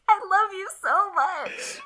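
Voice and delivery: high, loud, drawn-out gush; slow, high squeal of devotion; gushing adoration. Retold or imitated. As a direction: blurt out overjoyed love, hammed up. Acting out her gushing reply.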